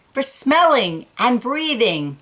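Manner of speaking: ordinary delivery, nothing marked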